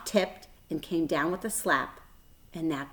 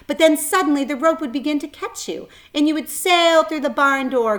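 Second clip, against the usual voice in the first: rising excitement; 8 semitones higher, 7 dB louder than usual. A narrator's reading. Narrator rides the swing.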